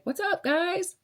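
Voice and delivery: squeaky high "What's up, guys?"; bubbly mock influencer hello; cutesy high-pitched hello; high chirpy greeting. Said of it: "I'm just like".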